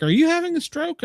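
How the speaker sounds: high-pitched mock alarm; retold incredulous question. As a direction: imitate baffled relatives checking on you.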